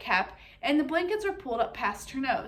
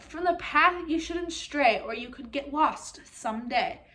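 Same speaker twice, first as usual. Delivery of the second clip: slow, lilting warning rhyme; slow sing-song rhyme. Acted. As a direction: recite it slowly like a nursery rhyme. Mother's rhyme recalled slowly.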